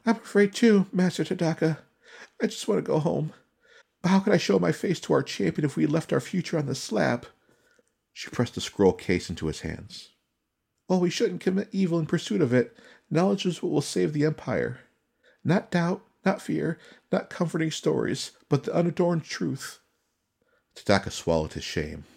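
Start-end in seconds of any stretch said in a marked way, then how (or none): 0.1-7.3 put-on much higher, louder young woman, scared yet determined; frightened but resolute Suki. Young retainer telling her master she won't turn back, scared but firm. 10.9-19.8 put-on much higher, louder young woman, scared yet determined; frightened but resolute Suki. Young retainer telling her master she won't turn back, scared but firm.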